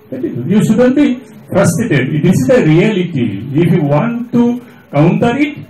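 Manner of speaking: ordinary talk, nothing marked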